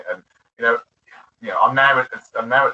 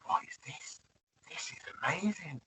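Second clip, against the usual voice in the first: breathless hushed wonder; awed whisper; 6 semitones higher, 16 dB quieter than usual. Retold or imitated. Young fan first watching Cocktail, awed whisper. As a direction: whisper it in awe. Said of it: "I was like".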